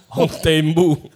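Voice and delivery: slow, laughing through the words. Real, not acted. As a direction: giggle out the Taiwanese attempt. Laughing through Taiwanese attempt.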